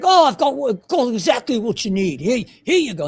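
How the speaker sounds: high-pitched amateur Irish lilt; attempted Irish accent. Pharmacist telling a customer he has just the thing, attempted Irish accent. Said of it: "my attempt at a Irish, uh, accent".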